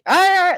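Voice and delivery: loud, high imitated yell; mock yell. Streamer showing how he sounds yelling at you, mock hyper yell. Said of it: "like he's yelling at you".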